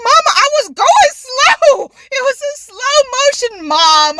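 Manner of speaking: high, loud whine to mom; hammed-up defensive complaint; retold indignant protest. Acted protest back to mom.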